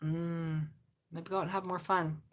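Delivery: ordinary talk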